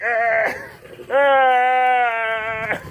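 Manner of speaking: long, high mock groan; drawn-out high "ahh" of a struggling machine; strained laptop groan. Vlogger imitating his struggling laptop, high strained groan. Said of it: "my laptop is still just like".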